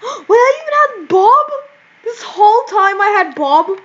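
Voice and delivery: gasp, then high, loud disbelief; astonished. Real, not acted. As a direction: blurt it out high after a gasp. Sudden discovery of a costume.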